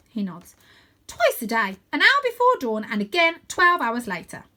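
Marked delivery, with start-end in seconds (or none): 1.1-4.4 loud, high, eager recital; keen, high-pitched boy's answer; emphatic answer. A narrator's reading. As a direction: reel off the facts at raised volume. Win answers Stella eagerly.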